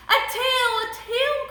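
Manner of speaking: put-on high, loud child's cry; high-pitched storybook exclamation; excited cry. A narrator's reading. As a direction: cry out as George spotting something. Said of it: "cried George".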